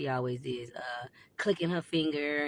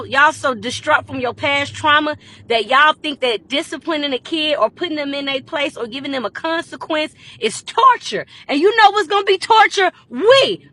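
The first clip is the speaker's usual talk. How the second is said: loud, higher furious rant; heated rant; 9 semitones higher, 14 dB louder than usual. Real, not acted. Ranting at viewers about undisciplined kids, loud fury. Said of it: "ranting at viewers".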